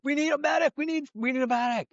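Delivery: mock urgent call. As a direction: call out for help. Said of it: "they're like".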